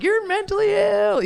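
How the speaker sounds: high mocking voice; high teasing friend voice. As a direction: squeal it like laughing friends. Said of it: "being like".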